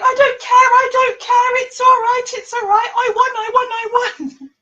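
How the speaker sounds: high, loud, gleeful chant; imitating excited winner. Imitating the winner telling her she doesn't care about the delay, high and gleeful. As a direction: squeal it like a giddy winner. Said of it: "she's so excited".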